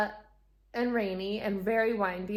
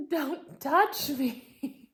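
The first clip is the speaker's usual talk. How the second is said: tearful plea; 6 semitones higher, much slower than usual. Retold or imitated. Crying on airport floor, dog jumps.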